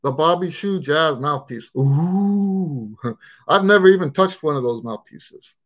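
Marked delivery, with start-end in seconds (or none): none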